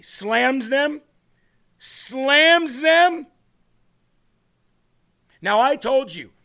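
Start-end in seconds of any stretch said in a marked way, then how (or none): 0.0-1.0 disbelieving, rising "Slams them?"; incredulous question. Real, not acted. Mocking media headline, first take. 1.8-3.3 much higher, louder "Slams them?"; outraged disbelief. Real, not acted. Repeats headline, escalating.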